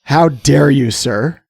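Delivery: theatrical tirade in a hoarse voice; mock outrage. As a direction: scold with pretend indignation. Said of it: "All right, calm down".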